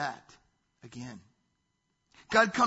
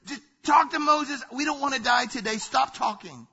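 loud, high, frantic plea; screaming out in terror; 7 semitones higher, 6 dB louder than usual. Acted. Terrified people pleading with God at Sinai, screaming out. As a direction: cry out, begging God to stop. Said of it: "And they scream out".

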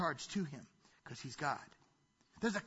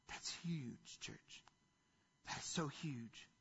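hushed, slow, much softer, almost breathless; hushed whisper; 5 semitones lower, 13 dB quieter, slower than usual. Real, not acted. Pastor to congregation on Job's words, hushed near-whisper. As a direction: drop to a near whisper for emphasis.

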